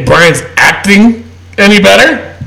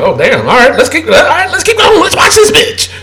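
excited; 8 semitones higher than usual. Retold or imitated. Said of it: "I'm like".

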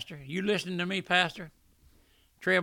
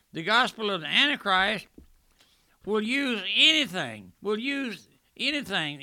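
louder, raised pulpit delivery; preaching at full force; 5 semitones higher, 4 dB louder than usual. Acted. Preacher denouncing the Antichrist gospel, at full force. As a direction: hammer each line like a sermon.

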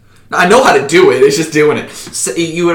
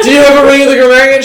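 rushed high question; 11 semitones higher, 8 dB louder, much faster than usual. Retold or imitated. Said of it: "you go".

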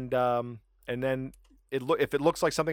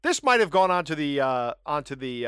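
usual speaking voice

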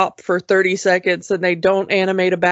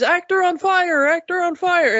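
much higher, calling-out alarm; screamed alarm; 8 semitones higher than usual. Retold or imitated. Crew member spotting Roger burning, higher alarm call. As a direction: raise the alarm across the set. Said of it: "somebody screams".

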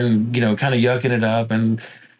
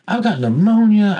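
retold panic, higher and louder; freaking out; 9 semitones higher, 4 dB louder than usual. Retelling his illness to the hosts, mock panicked. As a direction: relive the alarm of the news, pitched up. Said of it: "And so I am freaking out".

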